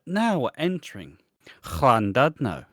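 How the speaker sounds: mock Welsh tannoy announcement; Welsh train announcer. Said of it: "Welsh trains are".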